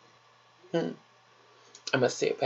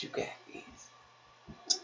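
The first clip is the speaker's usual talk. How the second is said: softer, slower whisper; whispered encouragement; 11 dB quieter, much slower than usual. Real, not acted. Whispering encouragement to a viewer on a hard day. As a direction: lean in and whisper it slowly.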